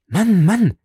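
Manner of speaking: put-on high, loud plea of a young woman; urgent cry. A narrator's reading. Beryl crying out a warning to the stranger, loud and high. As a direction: call him out sharply in alarm. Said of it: "rief sie".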